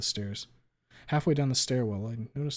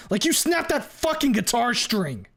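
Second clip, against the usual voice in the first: much higher, louder raging yell; roaring; 12 semitones higher, 7 dB louder than usual. A narrator's reading. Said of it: "I roar".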